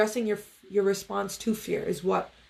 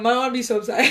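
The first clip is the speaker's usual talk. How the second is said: normal talking voice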